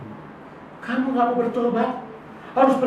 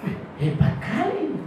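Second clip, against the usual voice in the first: inner thought; 6 semitones higher, 8 dB quieter than usual. Retold or imitated. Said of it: "Hati saya".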